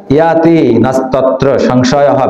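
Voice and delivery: সংশয়হ with a final breathy h; slow chant ending in an audible h; visarga sounded. Shown as a demonstration. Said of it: "বিসর্গ আছে না শেষে".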